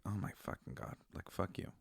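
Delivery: hushed exasperated retort. Retold or imitated. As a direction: mutter it back in disbelief. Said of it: "And I was like".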